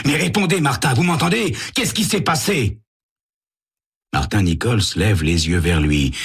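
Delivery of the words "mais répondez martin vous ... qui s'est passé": urgent plea. Acted. Sam shaking the dazed Martin.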